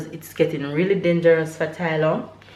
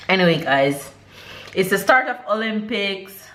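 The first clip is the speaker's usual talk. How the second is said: ordinary delivery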